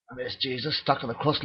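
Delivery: ordinary delivery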